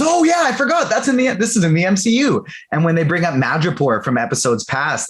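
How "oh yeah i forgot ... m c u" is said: much higher, delighted oh-yeah; sudden realization. Retold or imitated. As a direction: act out the penny dropping. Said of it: "it goes".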